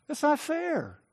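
a hurt, sulky grumble under the breath; quiet sulk. Retold or imitated. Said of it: "And then he goes on to say".

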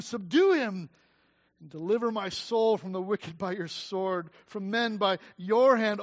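preaching the psalm at full force; impassioned scripture reading. Acted. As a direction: cry the psalm out to God.

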